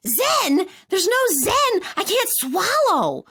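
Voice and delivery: high, loud protest, last words slow; panicked protest. Retold or imitated. Told to find a Zen place, a high loud panicked protest. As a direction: refuse the calm advice in alarm.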